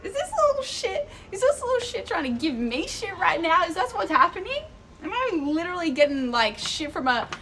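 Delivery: high-pitched incredulous questioning; mock outrage. Tourist teased by a six-year-old, high incredulous mock outrage. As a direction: squeal it in exaggerated disbelief. Retold disbelief at being teased.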